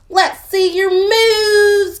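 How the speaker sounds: stretched out, high and loud; high slow pig call. Acted. Pig calls to Cow, high, slow and loud. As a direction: draw it out like a showing-off pig. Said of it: "called Pig".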